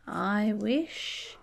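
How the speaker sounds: plain reading voice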